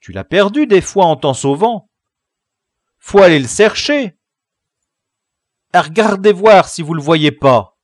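put-on loud, high-pitched boyish advice; urgent calling. A narrator's reading. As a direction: call it across to the gang. Boys hunting for lost trousers.

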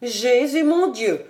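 lightly voiced mother moaning in sudden tears; a hint of a wail from the frightened mother; understated cry of dismay; mild tearful moan. A narrator's reading. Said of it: "gémit la mère tout à coup pleurante".

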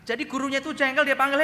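high, loud preacher's telling; fired-up preaching. Acted. Preacher booming the story to the church. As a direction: preach it out big to the congregation.

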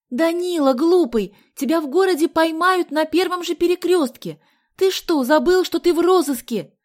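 high, loud exasperated shouting; shouted scolding. A narrator's reading. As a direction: scold at the top of your voice. Shouted argument on a motorbike.

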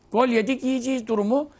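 ordinary talk

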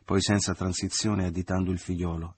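ordinary delivery, nothing marked

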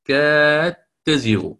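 كاد تزيغ said katteezighu, dal merged into ta, slow; slow and firm, dal swallowed by the ta; with assimilation. Shown as a demonstration. Teacher isolates the assimilated words for students. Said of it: "فأتى بالإدغام".

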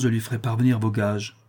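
ordinary delivery

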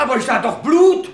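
hoch und laut, fassungslos; verblüffter Ausruf. Acted. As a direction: ruf es ungläubig heraus. Chefinspektor ist verblüfft. (der Inspektor protestiert hoch und laut vor allen)

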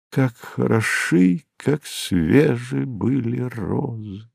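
slow, drawn-out, softly lilting line; wistful refrain. A narrator's reading. Reader recalls a line ringing in his head, wistfully. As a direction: let it rise, then linger softly.

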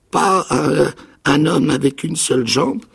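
high, loud stammered fear; stammering. A narrator's reading. Castaway stammers about the one-legged man, high and loud. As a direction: stumble over the words in dread. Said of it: "bégaya-t-il".

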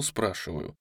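ordinary delivery, nothing marked

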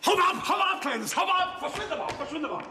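high urgent shooing away; hasty shooing. Acted. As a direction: push her out quickly, high. Schickt Alice hastig fort. (hastig hinausscheuchen)

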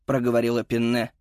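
plain reading voice

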